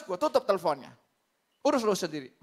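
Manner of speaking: curt re-enacted brush-off; fed up, ending the call; mildly angry dismissal; retold anger. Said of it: "jadi saya marah juga".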